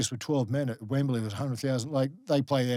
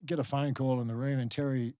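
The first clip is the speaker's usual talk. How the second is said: normal talking voice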